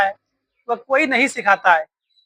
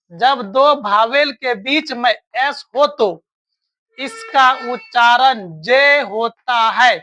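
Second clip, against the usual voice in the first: slow, every word spaced out; teacher chanting the rule deliberately; slow rule recital; slower than usual. Shown as a demonstration. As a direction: drill the rule slowly for the class. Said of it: "ध्यान सुनना है".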